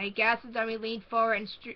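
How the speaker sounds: ordinary delivery, nothing marked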